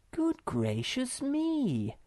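gently amazed; surprised exclamation. Acted. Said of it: "exclaimed Moomin Mamma".

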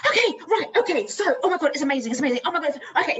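high, loud, breakneck excited babble; flustered excitement. Retold or imitated. Teacher learns the drawing is his, flustered excited outburst. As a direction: burst out overjoyed, tripping over yourself.

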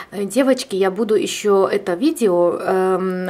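normal talking voice

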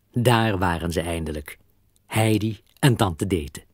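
ordinary delivery

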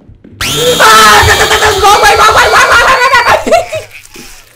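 long, loud, high babbling scream; real drawn-out panicked shriek; terrified shriek. Real, not acted. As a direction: scream and gibber at a jump scare. Jump scare after saying really scared.

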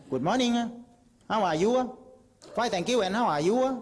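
high, loud English in a Southern Thai accent; English greeting with a sharp Southern Thai lilt and อ่ะ. Shown as a demonstration. Southerner greeting a foreigner in English, high Southern Thai accent. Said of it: "ยิ่งภาคใต้ยิ่งเสร็จเลย".

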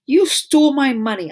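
high, loud indignant cry; angry accusation. Acted. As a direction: accuse her outright. Nancy accusing sister.

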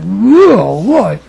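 loud wolf-like wail. Retold or imitated. Grandmother bursting into tears, loud howling wail. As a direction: howl it out like a wolf crying. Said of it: "大きな声で".

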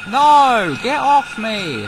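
high, loud, stretched-out protest; alarmed shout. Real, not acted. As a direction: yell to shake off an attacker. Shouting at an attacker in game.

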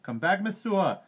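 high, loud calling back; high-pitched shouted order; crowd shouting. A narrator's reading. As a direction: yell after the woman as a mob. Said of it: "shouted the crowd".

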